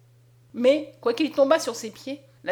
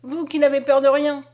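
loud, raised plea; pleading cry; 4 semitones higher, 7 dB louder than usual. A narrator's reading. Mother begging the soldier Bastien, loud and pleading. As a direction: beg the brave soldier. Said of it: "s'écria Julienne".

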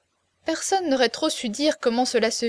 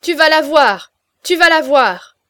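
loud, higher repeated roar; furious threat; 5 semitones higher, 11 dB louder than usual. A narrator's reading. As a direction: pound out the threat in rage. Giant roaring threat.

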